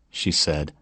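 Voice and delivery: usual speaking voice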